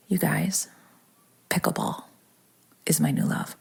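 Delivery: whispering. Real, not acted. Revealing her new love.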